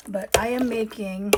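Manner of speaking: normal talking voice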